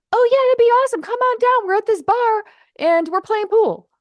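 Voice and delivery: a new friend on the phone inviting her to the bar, high and excited; imitated excited friend. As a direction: mimic a friend gleefully calling you over.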